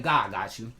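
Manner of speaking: slow, low and steady, like a calm promise; calm reassurance. Retold or imitated. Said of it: "I like".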